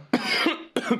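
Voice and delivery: sudden choking cough on a drink. Real, not acted. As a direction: cough hard as if something went down wrong. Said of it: "me atraganto y todo".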